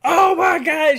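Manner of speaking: high, loud gasp of disbelief; excited outburst. Retold or imitated. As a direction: blurt it out, stunned and thrilled. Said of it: "and I'm like".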